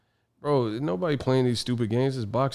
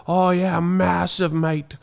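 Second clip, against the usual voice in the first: higher-pitched put-on British accent; hammed-up British "mate"; mock British fan; 5 semitones higher, slower than usual. Mocking British fans' reaction, exaggerated British accent. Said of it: "And the British teeth be like".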